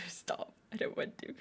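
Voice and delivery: tiny, whispered protest; barely voiced little plea; whispered refusal. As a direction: whisper it reluctantly. Whimpered refusal of chat's goal.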